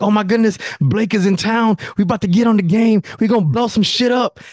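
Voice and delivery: bursting with game-night hype; husband telling wife his buddy is in town, excited. Retold or imitated. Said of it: "And I'm like".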